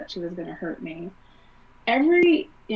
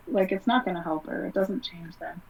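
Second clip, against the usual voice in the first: ordinary talk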